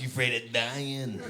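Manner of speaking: soft, put-on friend's taunt; buddy's taunting voice. Comic demonstrating how buddy talks, taunting. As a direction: mimic how the pal talks, softly goading. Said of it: "That's how he talks".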